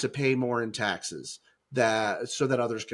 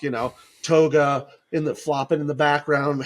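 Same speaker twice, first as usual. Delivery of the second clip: ordinary delivery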